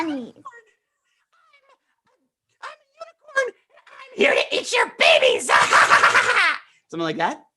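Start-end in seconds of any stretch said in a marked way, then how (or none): 0.0-5.5 very high squeaky shouting unicorn. Shown as a demonstration. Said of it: "It's a squeaky voice like the bunny". 5.5-6.6 high burst of laughter; loud laugh. Real, not acted. Laughs after squeaky demo.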